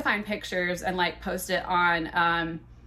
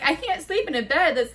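high-pitched retold complaint; exasperated protest; 7 semitones higher, 3 dB louder than usual. Woman to man about tilted bed, exasperated complaint.

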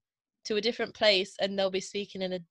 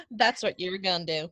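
podunk American accent; 3 semitones lower than usual. Shown as a demonstration. English learner has a go at the hillbilly American accent. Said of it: "Let me have a go".

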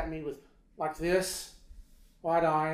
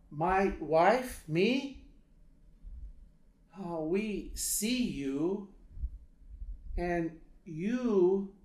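put-on louder, higher, Lebanese-accented broken English; halting Lebanese accent; 4 semitones higher, 5 dB louder, much slower than usual. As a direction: imitate a solemn Lebanese man, long pauses between words. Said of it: "he says to me".

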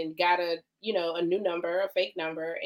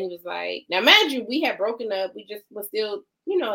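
usual speaking voice

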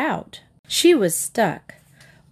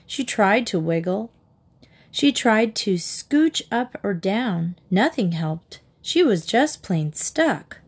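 plain reading voice

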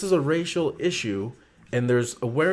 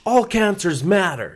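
higher-pitched, drawn-out mock slogan; in-your-face protest; 8 semitones higher, 4 dB louder, slower than usual. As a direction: mimic someone confronting people with a slogan. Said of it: "getting in their faces going".

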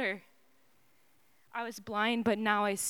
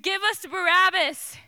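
loud, higher crowd shout; crowd scream; 7 semitones higher, 5 dB louder than usual. A narrator's reading. The crowd before Pilate, loud shout. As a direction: yell it like the mob demanding a prisoner. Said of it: "the people screamed".

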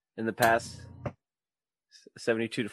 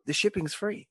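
upbeat sponsor read, pitched up; 6 semitones higher, 6 dB louder than usual. Acted. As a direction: sell it like a podcast ad.

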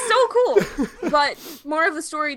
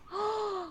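shocked gasp; 8 semitones higher, 11 dB quieter than usual. Real, not acted. Reacting to a harsh opinion.

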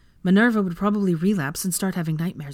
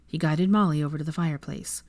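ordinary delivery, nothing marked

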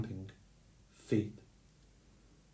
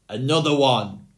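greedy, loud roaring demand; giant's roar; 3 semitones higher, 8 dB louder than usual. Acted. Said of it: "roared the giant".

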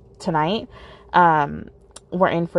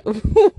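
light laugh; 6 semitones higher, 5 dB louder than usual. Real, not acted. Laughs about [name] obsession.

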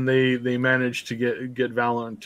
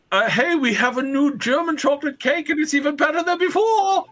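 very high, squealing mock sales pitch; hammed-up excited announcement. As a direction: hawk it in a silly, sky-high voice. Shop owner hawks new cake excitedly.

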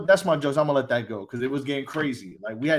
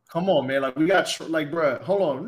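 ordinary talk, nothing marked

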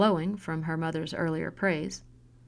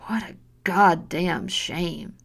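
scornful whisper; slower than usual. Acted. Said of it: "closing taunt".

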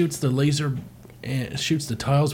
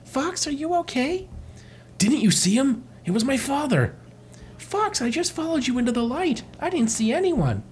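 high cartoon voice; 12 semitones higher than usual. Voicing a character in cartoon.

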